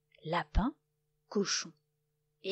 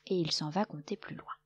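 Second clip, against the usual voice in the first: plain reading voice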